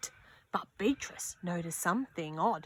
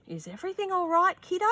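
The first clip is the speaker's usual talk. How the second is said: higher, quick caring question; concerned father; 10 semitones higher, much faster than usual. Acted. Father asks Beatrice at supper, quick and caring. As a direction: check on your kid. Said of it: "asked her father".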